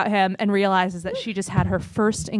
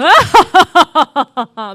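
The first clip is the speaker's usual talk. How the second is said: loud, high cackling villain laugh; maniacal laugh; 6 semitones higher, 10 dB louder than usual. Pretend. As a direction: let rip a mad villain cackle. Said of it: "can I get a maniacal laugh?".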